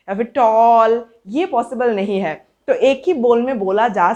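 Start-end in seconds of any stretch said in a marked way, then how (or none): 0.3-1.1 टॉल stretched out as one long sound; unbreakable single syllable. Shown as a demonstration. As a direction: hold the vowel of टॉल, no break. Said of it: "आप चाहो भी तो आप इसको तोड़ नहीं सकते". (टॉल को बिना तोड़े लंबा खींचकर)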